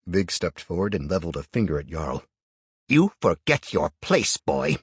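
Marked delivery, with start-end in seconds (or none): 2.9-4.8 slow, loud, lofty elder voice; haughty. A narrator's reading. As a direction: look down on the boy. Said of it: "he said haughtily".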